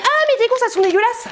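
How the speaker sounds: high mocking squeal of disgust; imitating Mireille. Imagining her friend Mireille mocking her groceries in a high mock-disgusted voice. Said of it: "je peux entendre Mireille".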